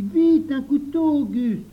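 loud, high, drawn-out shout; high boyish holler; urgent call. Acted. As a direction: shout for it, stretching the words. Louis urges the helper.

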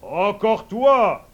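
slow, loud royal shout; angry king's shout. Acted. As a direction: bellow at the idiot. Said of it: "crie le roi".

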